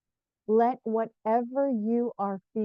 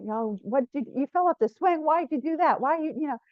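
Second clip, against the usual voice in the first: higher, louder mock telling-off; mock scolding; 5 semitones higher, 4 dB louder, faster than usual. Showing how not to judge a hurt child, mock scolding. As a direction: fire accusing questions, acting the judgmental adult.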